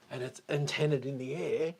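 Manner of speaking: normal talking voice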